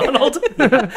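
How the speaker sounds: high laughing repeat. Real, not acted. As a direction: laugh while saying it. Name mix-up amuses group.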